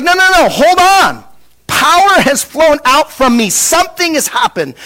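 high-pitched, emphatic acted command; urgent halt. Acts out Jesus halting everyone.

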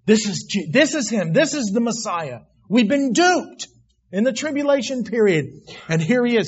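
high, loud cry of sudden recognition; stunned realization. Acted.

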